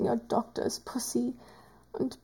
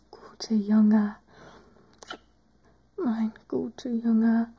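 tender whisper; 2 semitones lower than usual. Acted. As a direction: whisper it fondly, winding down.

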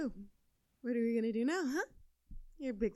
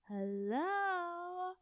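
normal talking voice

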